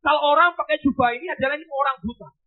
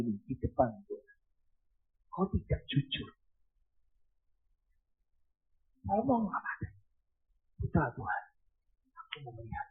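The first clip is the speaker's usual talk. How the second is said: much softer, low, intimate urging; hushed appeal; 9 semitones lower, 10 dB quieter than usual. Real, not acted. Preacher quietly urging honest Christians, hushed appeal. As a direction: lean in and speak softly.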